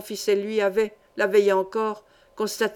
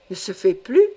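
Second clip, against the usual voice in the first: louder, disbelieving question; startled cry; 3 semitones lower, 5 dB louder than usual. A narrator's reading. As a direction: exclaim it in alarm, rising at the end. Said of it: "s'écria le vieux mineur". (s'exclamer avec surprise, plus fort)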